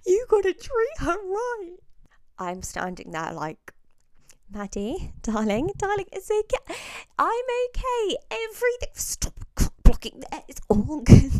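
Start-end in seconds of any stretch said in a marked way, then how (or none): 0.1-1.8 put-on high, pleading drunk friend voice, a touch weepy; hammed-up weepy drunk warning to the new boyfriend; drunk tearful friend. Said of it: "This is what she's saying". 4.5-11.3 high, loud, mock soothing; calm her down loudly over the noise; hammed-up reassurance to a sobbing friend; soothing drunk friend.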